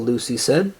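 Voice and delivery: ordinary delivery, nothing marked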